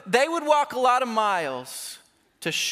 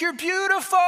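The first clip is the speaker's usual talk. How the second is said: high, adoring exclamation; acted smitten amazement, pitched way up; lovestruck awe; 9 semitones higher, faster than usual. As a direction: gush in wonder at the beloved. Lover marvels at the beloved.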